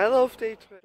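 high, bright burst of glee; excited. Real, not acted. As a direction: gush about the trip.

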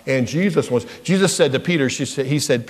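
normal talking voice

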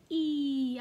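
long stretched "E." with a smile. Shown as a demonstration. Teacher showing the smiley E sound. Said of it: "Our stretched, smiley-faced sound".

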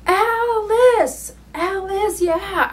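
cheering her dying friend on while dancing her arms; excited calling out. Real, not acted. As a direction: rouse her friend with a joyful high call.

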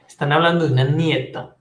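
ordinary delivery

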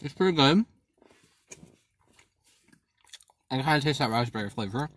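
talking while chewing food. Real, not acted. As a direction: mouth partly full. Seen 4: Cheeks bulge, lips pursed mid-chew between words; ate before.

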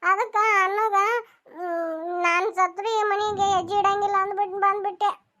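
high, crying voice, choking on the words; tearful. Acted. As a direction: pour out the hurt while weeping. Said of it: "ಮೊದ್ಲೇಕ್ ನೀ ಅಳುದ್ ನಿಂದ್ರಸು". (ಅಳುತ್ತಾ ನೋವಿನಿಂದ ಮಾತನಾಡು)

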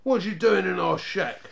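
high, accusing snap; snapped demand. A narrator's reading. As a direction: challenge the intruder sharply. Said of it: "snapped the first Snarget".

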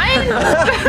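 high burst of laughter. Real, not acted. Loud laugh.

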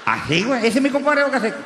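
usual speaking voice

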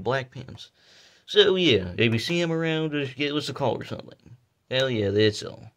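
playful mock news anchor read; news report. Kid plays news anchor on TV.

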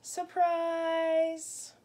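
high, loud mock shout; mock surprise shout; playful yell. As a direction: burst it out like a party surprise. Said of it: "the tree yelled".

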